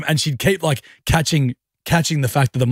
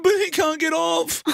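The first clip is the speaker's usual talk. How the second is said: high, slow teary plea; mock whimper. Husband mimicking wife upset over stuck robot, high slow whimper. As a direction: whimper it slowly like a sad child. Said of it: "She's going".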